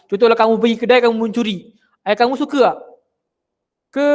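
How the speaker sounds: normal talking voice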